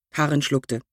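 plain reading voice

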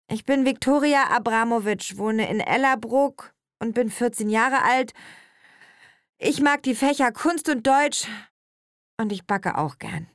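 a hint of a teenage girl; schoolgirl introducing herself. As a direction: recite your details like a schoolgirl.

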